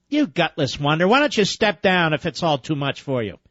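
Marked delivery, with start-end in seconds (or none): none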